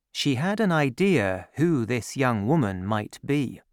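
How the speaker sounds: usual speaking voice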